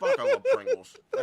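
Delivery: high laughing voice; laughing squeal. Real, not acted.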